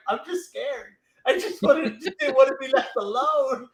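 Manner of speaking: high, laughing, mock-tearful whine; squeaky scared little voice through laughter; mock scared whimper. Finn voiced as scared, whiny.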